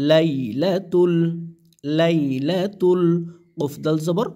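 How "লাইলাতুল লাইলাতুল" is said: লাইলাতুল drawn out slowly, syllable by syllable; slow model pronunciation. Shown as a demonstration. Teacher slowly models লাইলাতুল for students. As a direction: sound out লাইলাতুল slowly, each syllable clear. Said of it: "লাম জবর লা, তালাম পেশ তুল।".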